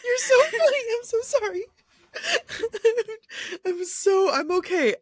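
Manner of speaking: breaking up, trying and failing to stop laughing; the giggles. Real, not acted. Cohost apologizing to her partner through uncontrollable giggles. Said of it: "I have the giggles".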